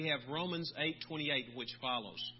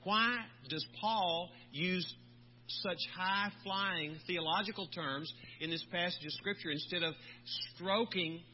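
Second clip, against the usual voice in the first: usual speaking voice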